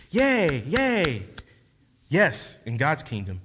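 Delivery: normal talking voice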